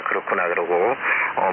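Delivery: normal talking voice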